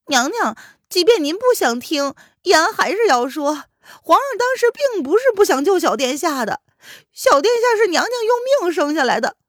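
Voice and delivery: high, loud pleading servant voice; an anxious servant begging to be heard. Acted. Servant pleads for his master.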